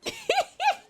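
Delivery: high, light doll giggle; mock creepy giggle. Gamer mimicking the doll's giggle, high giggle. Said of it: "giggling like this".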